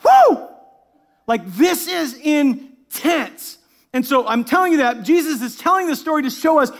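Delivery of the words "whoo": high, loud "whoo"; amazed whoop. Retold or imitated. Preacher showing their reaction on reading the story, whooping. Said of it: "it's like".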